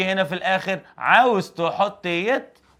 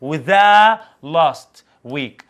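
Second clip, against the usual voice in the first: the last week stretched very slowly and said much louder; stress on the last week; 8 dB louder, much slower than usual. Shown as a demonstration. Teacher hammering the last week into students, very slow and loud. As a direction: lean hard on the last week, word by word. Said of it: "عمال تتك على كلمة the last week".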